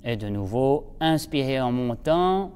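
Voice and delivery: low, soft breathing-guide voice; guided breathing. Acted. Instructor guiding students' breaths, soft and calm. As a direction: lead the class through slow breaths.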